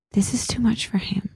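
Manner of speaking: whisper. Acted. Wife whispering to husband about their son, mock-dramatic whisper. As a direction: hush it like a soap-opera confession.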